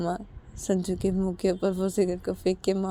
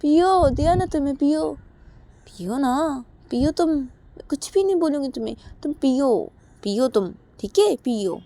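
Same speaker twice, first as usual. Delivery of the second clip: much higher, loud mocking insistence; angry taunt; 9 semitones higher, 8 dB louder than usual. Uma angrily goads Sanju to smoke the cigarettes. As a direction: goad him bitterly to smoke. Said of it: "तो तभी उमा बोलती".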